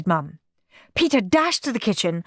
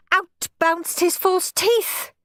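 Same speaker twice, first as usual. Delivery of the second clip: plain reading voice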